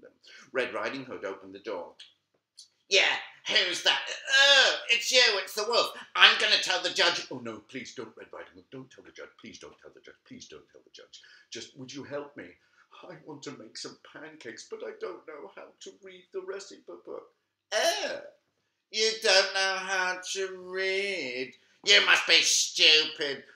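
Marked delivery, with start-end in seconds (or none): 2.9-7.3 put-on high, loud tattletale girl; threatening to tell on him; bratty girl. 7.3-17.3 a meek wolf begging quietly; pleading wolf. Acted. Wolf begs. 17.7-23.4 high, loud, slow sneer; a snotty girl teasing him; mocking girl.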